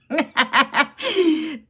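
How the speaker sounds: a high, loud burst of laughter at a compliment; amused laugh. Real, not acted. Streamer laughing off a viewer's compliment, high amused laugh. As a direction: laugh off the flattery.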